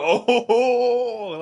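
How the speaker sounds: long, high, drawn-out "oh-ho-ho"; mock egging-on "ohh". Shock jocks encouraging the chaos, mock egging-on. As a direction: whoop it up like goading radio jocks. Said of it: "like that".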